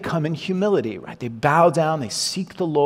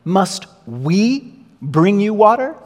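louder and higher, slow, contemptuous challenge; scornful Moses; 5 semitones higher, 6 dB louder, slower than usual. Retold or imitated. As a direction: sneer down at the crowd from the rock. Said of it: "He says".

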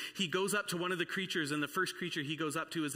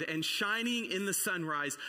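ordinary talk

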